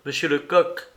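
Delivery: plain reading voice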